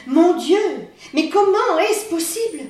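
cri de stupeur, plus haut et plus fort; s'exclamer, incrédule, la voix qui monte. A narrator's reading. Exclamation de stupeur du narrateur. (stupeur incrédule, plus haut et plus fort)

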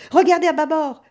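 bellowing over the wind; high, loud urgent shout; yelling. A narrator's reading. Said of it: "hurla Valentino".

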